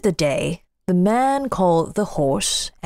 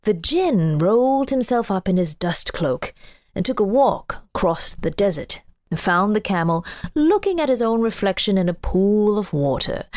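ordinary delivery, nothing marked

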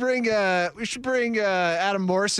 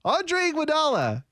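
high-pitched roll-call delivery; high list-reading voice; put-on name-reading voice; 9 semitones higher than usual. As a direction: read the name out high, without the accent. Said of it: "how about you just read it".

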